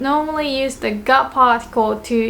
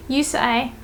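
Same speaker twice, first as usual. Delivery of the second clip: usual speaking voice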